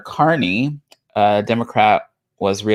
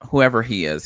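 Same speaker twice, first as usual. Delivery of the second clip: ordinary delivery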